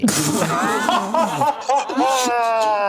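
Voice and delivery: long, high burst of laughter; high-pitched laugh. Real, not acted. Listener reacting to a story turning to hell, high laugh.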